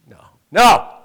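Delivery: a loud, high mock "no, no"; loud denial. Pastor jokingly denying he punched the man, loudly. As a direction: protest it out loud. Joke about punching the guy.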